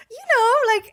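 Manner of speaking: very high, loud, breezy certainty; full confidence. Retold or imitated. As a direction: sell it as if nothing's wrong. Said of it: "I repeated with the full confidence".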